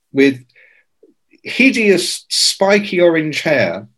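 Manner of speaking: ordinary talk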